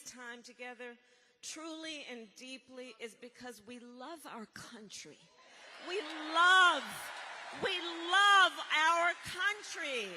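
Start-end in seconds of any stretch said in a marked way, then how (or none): 5.9-10.1 rally shout. Acted. Rally climax, shouting to crowd.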